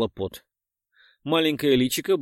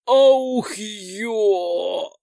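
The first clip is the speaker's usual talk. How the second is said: loud pained yelp; pained cry; 8 semitones higher, 4 dB louder than usual. Acted. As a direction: cry out from sudden pain. Hero cries out in pain. (крик боли)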